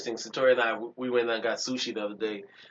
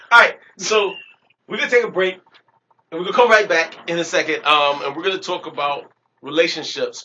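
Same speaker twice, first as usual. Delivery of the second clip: loud, high host announcement; announcer voice; 6 semitones higher, 11 dB louder than usual. Real, not acted. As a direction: hype up the break like a radio host. Podcast host announcing break.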